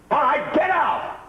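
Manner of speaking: a raging man ordering his agent out; angry shout. Real, not acted. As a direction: order him out, furious and dragged out.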